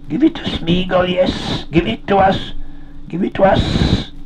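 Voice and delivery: high, hissing creature voice; high pleading hiss; wheedling Gollum. A narrator's reading. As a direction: hiss it greedily, drawing out the s. Gollum's voice in a story.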